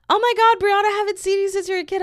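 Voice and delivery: high, loud, fast gushing greeting; gushing girl. Retold or imitated. Island girl greeting Brianna, high and gushing. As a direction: squeal it like an old acquaintance.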